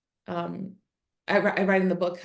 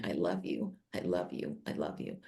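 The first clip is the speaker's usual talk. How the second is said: fading from a low murmur to a bare whisper; whispered I love you; 10 dB quieter than usual. Retold or imitated. Mother whispering love to her raging son, fading to a whisper. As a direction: breathe it ever softer, almost silent.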